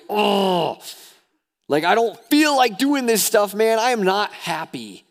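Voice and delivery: high, loud mock whining protest; hammed-up discontented outburst; exasperated complaint. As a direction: act out a fed-up worshipper protesting. Voices a discontented worshipper's complaint.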